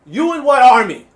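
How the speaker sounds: loud, high taunting retort; mock taunt. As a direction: throw it out as a hammed-up challenge.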